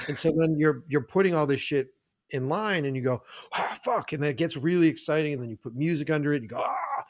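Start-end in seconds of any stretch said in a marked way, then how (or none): 3.5-4.1 a high, delighted gasp of a curse; thrilled curse. Retold or imitated. Filmmaker thrilled as the edit falls into place. Pieces suddenly coming together. 6.6-7.0 a high, soft exclaim of wonder; a gasp of pleasure at the music; delighted ah. Retold or imitated. Editor hearing music under the cut, delighted.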